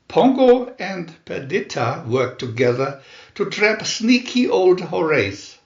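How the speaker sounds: ordinary delivery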